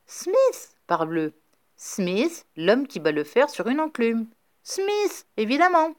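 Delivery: high-pitched excited exclaiming; excited eureka voice; sudden realisation. A narrator's reading. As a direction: it dawns on him, exclaim. Rafferty realises the truth.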